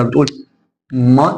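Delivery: ordinary talk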